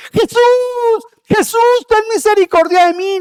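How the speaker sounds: clamor a gritos, muy agudo; grito más fuerte. Acted. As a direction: grita pidiendo misericordia a todo pulmón. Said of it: "Y él gritaba más fuerte". (súplica desesperada del ciego, gritada y aguda)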